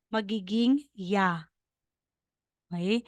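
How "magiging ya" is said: magiging ya, with ya said slowly and clearly as y plus a; slow demo of ya. Shown as a demonstration. Teacher shows how two strokes make ya, slow demo. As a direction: spell out the sound ya slowly. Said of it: "Pag dalawa po yung guhit".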